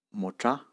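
mocha with mo low and cha rising high; low then high tone. Shown as a demonstration. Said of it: "On commence ton bas, mo, ... cha, et un ton haut".

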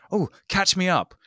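hammed-up promo line; mock promo actor. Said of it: "acting in the strangest way".

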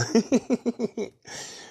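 high-pitched giggle. Real, not acted. Man recalling his old teacher to listener, high giggle. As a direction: giggle at a fond memory.

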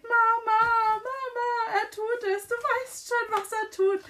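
put-on high, loud wailing child; wailing Dudley. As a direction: wail for mama like a scared kid. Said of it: "heult nach seiner Mutter ... und schreit".

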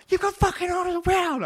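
high, loud furious yell; chef's shout. Retold or imitated. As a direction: scream about the waiting orders. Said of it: "he's going".

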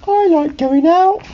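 very high, slow cartoon voice; eager high-pitched banana; banana character voice. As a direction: pipe up high and drawn out. New banana character speaks up.

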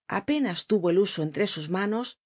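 ordinary delivery, nothing marked

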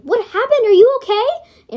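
high, loud worried voice; caring alarm. A narrator's reading. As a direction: rush to comfort him. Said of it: "Amber said caringly".

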